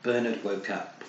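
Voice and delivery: ordinary delivery, nothing marked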